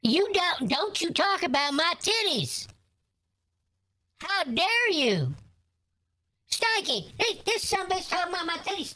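high, huffy granny outrage; mock outrage. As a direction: play a crude grandma's hammed-up indignation. Granny offended by scammer.

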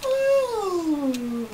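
long high yawn. Real, not acted. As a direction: let out a big yawn. Friend keeps yawning.

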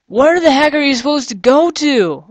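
loud, high exasperated cry; frustrated outburst. Real, not acted. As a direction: burst out, totally lost. Lost in the maze, frustrated.

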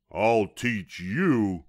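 loud, long drawn-out howl. A narrator's reading. Burned varmint threatening the bunny, howling. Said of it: "he howled".

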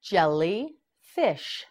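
Jellyfish drawn out slowly, each syllable clear; slow teaching demo. Shown as a demonstration. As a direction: stretch out Jellyfish slowly for learners. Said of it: "Now it's your turn".

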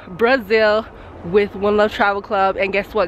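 usual speaking voice

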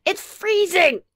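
high, loud cry of complaint; alarmed exclamation. A narrator's reading. Phil Junior comes in from freezing outside, loudly exclaiming. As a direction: exclaim it, pitch way up.

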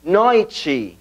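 NOITE as "NOY-chee"; Portuguese pronunciation. Shown as a demonstration. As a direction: glide oi, then "chee" to end NOITE. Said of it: "Noche sería entonces".

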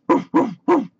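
high, loud little barks; dog barking. A narrator's reading. Dog calling to the busy spider, barking. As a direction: bark three times like a dog. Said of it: "barked the dog".

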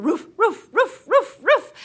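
high, loud, short barking; dog barks. Pretend. Fly's drum solo with animal sounds, high yapping barks. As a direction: bark like an excited little dog.

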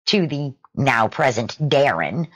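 ordinary delivery

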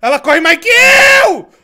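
panicked cry, high and loud; being chased. Real, not acted. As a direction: yell in fear while fleeing.

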